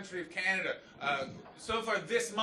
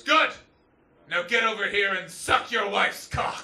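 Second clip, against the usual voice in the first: menacing prisoner; 2 semitones higher, 8 dB louder than usual. Retold or imitated.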